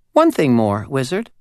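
high, tiny, eerie doll voice; a small uncanny doll spirit speaking. Acted. Doll adds a warning.

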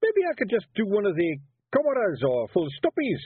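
high, Scottish Feegle warrior protesting; high Scots pixie voice wheedling his way out. A narrator's reading. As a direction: plead like a small nervous Scottish warrior. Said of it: "he protested".

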